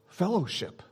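normal talking voice

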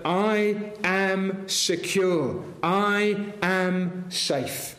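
very slow, each word weighed; slow and certain. Acted. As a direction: let the certainty sink in slowly. Drumbeat of certainty, slowed.

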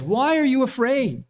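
a teacher scolding pupils who still don't get it; Jesus, exasperated. Retold or imitated. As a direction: press each question with weary frustration. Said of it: "Jesus says".

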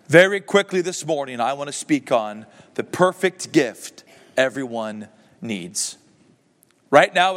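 ordinary delivery

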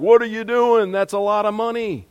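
high, loud indignant protest; indignant outcry. Retold or imitated. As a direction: cry out like outraged disciples. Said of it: "they were indignant".

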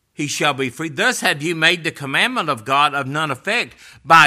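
loud, emphatic scripture rebuke; forceful preaching. Real, not acted. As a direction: drive the accusation home to the congregation.

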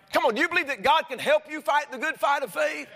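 preaching at full force, rising to a shout; fiery preaching. Acted. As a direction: rouse the congregation.

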